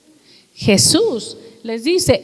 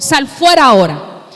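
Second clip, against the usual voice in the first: louder, forceful exorcism command; commanding order; 6 dB louder than usual. Retold or imitated. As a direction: order the demons out, acting as Jesus. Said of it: "Jesús a la orden les iba a decir".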